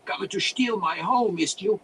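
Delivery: put-on high, loud accented wizard voice; angry accusation. A narrator's reading. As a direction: accuse intruders in a cranky foreign voice. Wizard accuses intruders of theft.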